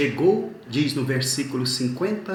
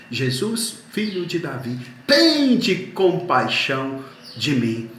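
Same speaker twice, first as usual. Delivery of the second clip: loud, long-held pleading call; slow preacher's shout of the blind man's plea; retold louder cry; 3 semitones higher, 5 dB louder than usual. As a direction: imitate a desperate cry for mercy, stretching the first word. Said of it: "Ele começa a gritar mais alto".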